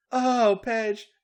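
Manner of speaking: high loud moans; moaning. Acted. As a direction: moan out the words. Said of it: "Charlotte moaned".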